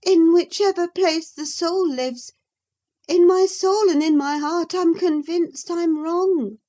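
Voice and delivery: put-on a young lady answering back, pitched high; Catherine. Catherine's voice in the reading.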